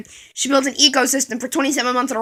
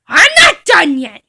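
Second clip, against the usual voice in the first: louder, higher insistence; mock indignant shout; loud protest; 6 semitones higher, 9 dB louder, slower than usual. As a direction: push back loudly. Kid protests loudly.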